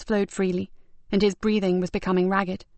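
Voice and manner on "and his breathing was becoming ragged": usual speaking voice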